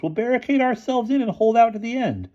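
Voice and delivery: high, defiant storming; defiant anger. A narrator's reading. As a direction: vow it angrily. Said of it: "stormed Boots".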